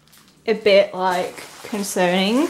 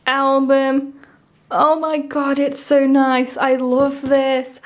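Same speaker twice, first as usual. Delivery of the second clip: ordinary talk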